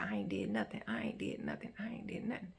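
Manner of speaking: very soft, half-whispered protest; hushed denial. Retold or imitated. Imitating him denying it to her, hushed. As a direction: mimic repeated denial under the breath. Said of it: "He's denying".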